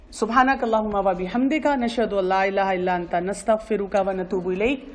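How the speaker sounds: low, even recited Arabic dua; closing prayer. Real, not acted. Speaker closes the class with a dua, recited low.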